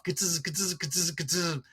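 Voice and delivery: quick clicking flash noises; camera flash sound. Retold or imitated. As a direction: mimic flash bulbs popping. Imitating camera flash bulbs going off.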